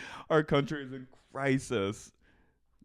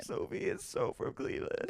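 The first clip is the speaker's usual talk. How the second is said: whiny mock voice; 13 semitones higher, 7 dB quieter than usual.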